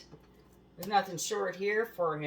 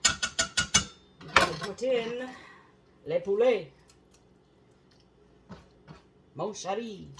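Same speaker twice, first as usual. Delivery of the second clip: hammed-up French chef voice, slow start; lightly put-on French accent, louder; mock French; 4 semitones higher, 6 dB louder, slower than usual. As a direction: play a fake French chef. Mock French while cooking.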